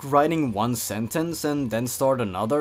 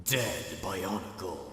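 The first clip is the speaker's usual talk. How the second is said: slow, much softer, hushed spooky title read; ominous title; 7 semitones lower, 10 dB quieter than usual. Acted. Reading the creepypasta title to viewers, slow and hushed. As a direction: announce the title like a horror host.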